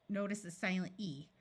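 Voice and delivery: normal talking voice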